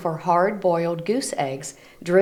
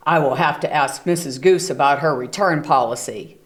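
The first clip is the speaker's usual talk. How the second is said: grumpy bear Bruce; 4 semitones lower, faster than usual. Acted. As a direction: lower gruff bear mutter. Bruce's voice in the story.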